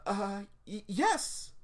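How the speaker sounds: high stammer bursting into a yes; stumble, then squeak out the answer; flustered stammer. Acted. Shy girl asked to hold something, flustered.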